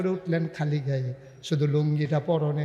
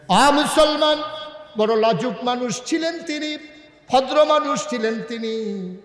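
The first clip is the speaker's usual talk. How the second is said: raised, high preaching call; impassioned preaching; 7 semitones higher, 5 dB louder than usual. Real, not acted. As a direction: cry out to the congregation.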